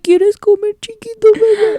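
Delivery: high-pitched mock baby talk; doting mom. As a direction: coo in Spanish like a babying mother. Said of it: "that's literally my mom".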